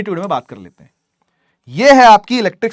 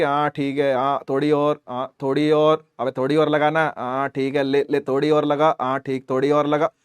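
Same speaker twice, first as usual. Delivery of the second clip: lazy stubborn material; 6 semitones lower, 4 dB quieter than usual. Acted. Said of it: "घणाई जिद्दी material".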